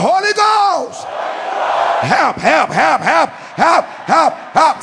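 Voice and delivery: high repeated calls of help; shouting for help. Shown as a demonstration. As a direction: cry out help, help, over and over. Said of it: "Somebody shout".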